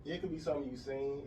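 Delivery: normal talking voice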